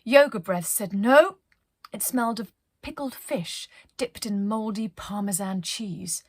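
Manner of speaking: plain reading voice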